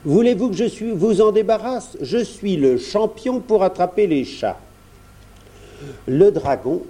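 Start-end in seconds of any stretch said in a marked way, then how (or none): 0.0-4.6 put-on high boyish character voice; helpful little prince. A narrator's reading. Prince offers to cure the dragon, helpfully. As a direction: offer help in a high, confident child voice.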